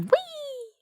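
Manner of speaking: squeaky high fading whee; a tiny gnome flying off; gnome's whee. A narrator's reading. Gnome tossed over the wall, squeaky fading whee. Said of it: "with a waning".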